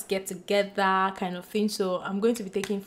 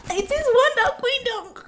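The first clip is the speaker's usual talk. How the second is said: high squealed title; thrilled at finally getting the book; excited squeal; 13 semitones higher, 4 dB louder than usual. Real, not acted. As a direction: shriek it with delight.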